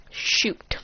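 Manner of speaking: breathy whispered shoot; soft whispered oops; whispered curse. Acted. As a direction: mutter it under the breath in dread. Realizing he messed up.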